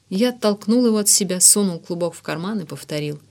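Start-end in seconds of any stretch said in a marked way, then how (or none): none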